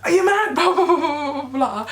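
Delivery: high, loud, half-laughing shriek; Ruby shrieking with laughter at the mad driver; laughing yell. Retold or imitated. Ruby yelling at the reckless driver through laughter.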